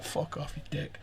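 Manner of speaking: breathy whispered insult, joking; whispered mock retort. Whispering the retort he expected from the players. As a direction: whisper it like a friendly jab.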